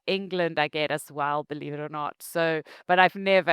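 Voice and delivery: ordinary talk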